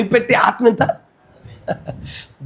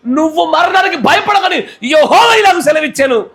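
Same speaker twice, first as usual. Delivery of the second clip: fervent shout; 7 semitones higher than usual. Real, not acted. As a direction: proclaim at full voice, rising pitch. Shouted proclamation. (బిగ్గరగా అరుస్తూ ప్రకటించడం)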